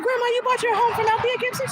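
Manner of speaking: very high-pitched incredulous question; amazed question. Retold or imitated. Granddaughter asks grandma about her famous seller, amazed. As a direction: squeal it in disbelief, imitating your younger self.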